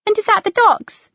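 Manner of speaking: put-on a child asking eagerly; schoolchild. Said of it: "one of a small party of school children".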